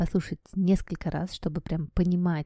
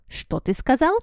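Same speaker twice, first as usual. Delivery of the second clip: higher sharp barked question; snapping aunt; 7 semitones higher, 4 dB louder than usual. Acted. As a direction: snap it through the door. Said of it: "рявкнула на него тётя".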